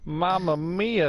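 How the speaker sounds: hammy Italian "Mamma mia", slow; drawn-out fake Italian accent; mock Italian. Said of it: "you're going to have to talk like this".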